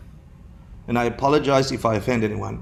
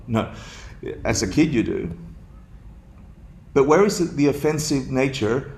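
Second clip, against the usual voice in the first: usual speaking voice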